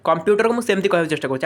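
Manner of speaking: ordinary delivery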